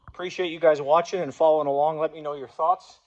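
ordinary talk

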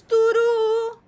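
high, sustained sing-out answer; drawn-out verdict. Pretend. Answering a personality quiz, high and drawn-out. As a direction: stretch the vowel on one held note.

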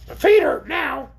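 high, shrill creature complaint; grumbling. A narrator's reading. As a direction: grumble in a piercing, slow orc voice. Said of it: "grumbled the orc".

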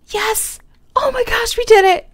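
high, loud burst of joy; thrilled squeal. Real, not acted. Gamer cheering after taming the stray cat, high squeal. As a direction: squeal with delight at the win.

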